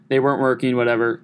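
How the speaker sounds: normal talking voice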